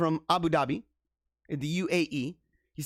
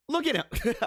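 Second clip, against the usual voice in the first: laughing. Real, not acted. Laughing at a video clip.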